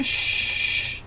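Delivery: soft shushing hiss; hushing. Real, not acted. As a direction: hush the dog. Hushing scared dog.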